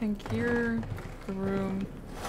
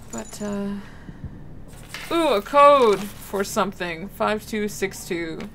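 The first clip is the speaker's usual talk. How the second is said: ordinary talk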